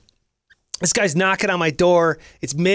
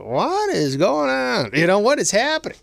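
confused; 6 semitones higher than usual. Retold or imitated. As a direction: play it dazed and lost. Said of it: "And I was like".

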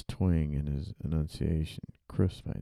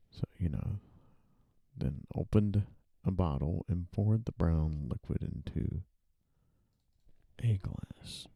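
ordinary talk

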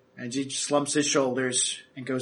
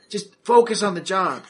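Terrence's voice; 5 semitones higher, 5 dB louder than usual. Acted. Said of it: "Terrence is like".

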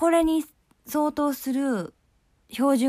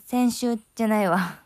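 usual speaking voice

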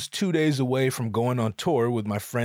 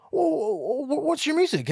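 curious host; 14 semitones higher than usual. Retold or imitated.

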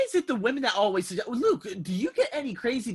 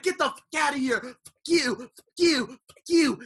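raised, punchy F-bombs; imitating a man throwing someone out; retold angry cursing; 5 semitones higher, 4 dB louder than usual. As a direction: hurl each curse as a separate jab. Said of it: "Just F-bombs flying everywhere".

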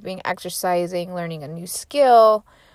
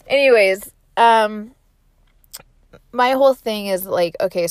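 usual speaking voice

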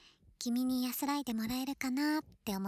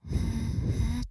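ear-blowing puffs; 6 dB louder, slower than usual. Shown as a demonstration. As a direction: blow gently on the ear. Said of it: "こう、".

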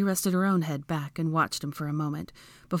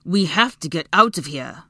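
raised voice, mounting panic; panicked shout; 6 dB louder than usual. A narrator's reading. Draco to Hermione, trapped, shouting in panic. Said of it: "shouted at her, panic rising in his voice".

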